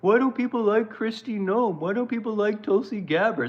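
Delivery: mocking Trump whining about his cabinet; mock crying Trump. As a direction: whine it like a sulking child. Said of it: "He's crying like".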